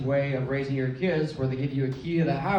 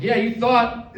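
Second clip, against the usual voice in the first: louder, higher mock taunt with a laugh; gloating grandma; 8 semitones higher, 7 dB louder, much slower than usual. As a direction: lord it over them. Grandma gloating over soccer moms.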